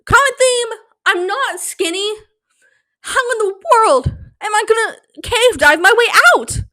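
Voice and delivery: high whiny despair; panicked whine. Pretend. Stuck in the scenario, a high panicked wail. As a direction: throw up your hands in a high wail.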